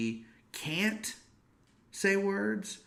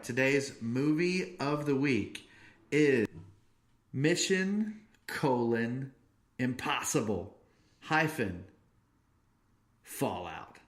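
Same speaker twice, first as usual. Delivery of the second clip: announcer intro; 3 semitones higher, slower than usual. Acted. Announces movie title.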